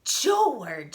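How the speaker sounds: loud scolding call; sharp motherly yell. Acted. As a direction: call out the name like a cross mother. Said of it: "she yelled".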